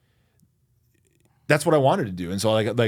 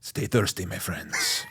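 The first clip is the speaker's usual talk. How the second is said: put-on suave Spanish accent, understated; most interesting man in the world; 2 semitones lower, 4 dB quieter, slower than usual. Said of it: "Can you do the accent?".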